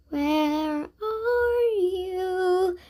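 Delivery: very slow, drawn-out eerie call; lightly voiced ghost girl. A narrator's reading. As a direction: stretch it out like a lost spirit. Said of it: "The girl's voice echoed".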